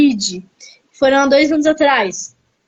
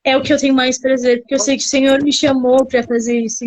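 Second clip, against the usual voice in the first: normal talking voice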